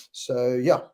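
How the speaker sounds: normal talking voice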